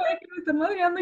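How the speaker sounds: high, tearful hysterical wail; hysterical crying. Retold or imitated. Scared girl sobbing by the cemetery, high hysterical wail. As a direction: sob it out in terror. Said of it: "y yo así de que llorando, histérica".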